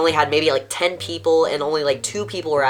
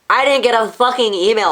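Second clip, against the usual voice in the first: much higher and louder, frustrated protest; exasperated outburst; 9 semitones higher, 6 dB louder than usual. Real, not acted. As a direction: burst out in disbelief at the unfair rule. Arguing with the con op.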